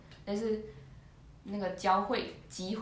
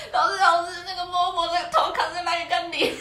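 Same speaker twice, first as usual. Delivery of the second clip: high, louder, laughing cry for the teacher; urgent calling; 8 semitones higher, 8 dB louder than usual. Retold or imitated. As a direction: call the teacher in a laughing panic. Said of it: "赶快去把老师说".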